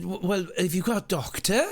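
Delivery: put-on a light Welsh lilt, small boy asking; Welsh child accent. Nephew asks about a doctor, lightly Welsh-accented child voice. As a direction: ask like an earnest Welsh toddler. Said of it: "He was Welsh".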